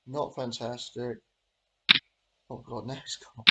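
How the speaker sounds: usual speaking voice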